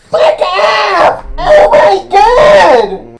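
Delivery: high, loud outburst stretched slowly into a wail; exasperated shout. Real, not acted. Reacting to the movie's bald joke, real exasperated shouting. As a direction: yell in real disbelief.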